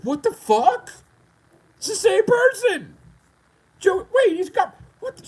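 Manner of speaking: hammed-up confused crowd; baffled onlookers. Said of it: "everybody's like".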